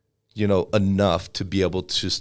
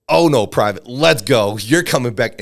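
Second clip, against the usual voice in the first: loud, raised-pitch mock drill sergeant order; 5 semitones higher, 8 dB louder than usual. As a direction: imitate a drill sergeant hauling a private back. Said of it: "the drill sergeants looked and were like".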